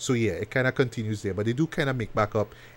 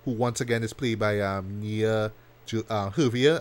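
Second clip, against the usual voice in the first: normal talking voice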